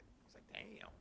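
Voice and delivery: whispered aside; awed whisper. Real, not acted. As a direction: drop to a breathy hush.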